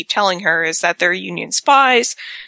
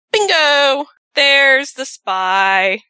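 triumphant bingo; 7 semitones higher, much slower than usual. Retold or imitated. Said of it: "and Wyatt's like".

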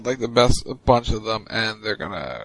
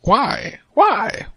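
high-pitched, repeated question; exaggerated high cry of why; mock pleading; 9 semitones higher, 4 dB louder than usual. Echoes a wrestler's plea, exaggerated.

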